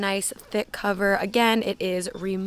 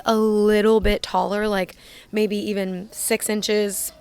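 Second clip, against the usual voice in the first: ordinary delivery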